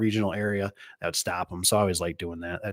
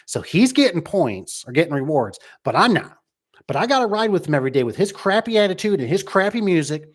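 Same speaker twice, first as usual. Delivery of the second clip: higher, aggrieved complaining coworker; a hint of a griping employee, voice rising; whiny coworker; 8 semitones higher, 4 dB louder than usual. Coworker griping to the boss about unfair rewards, higher whine. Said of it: "another employee that's like".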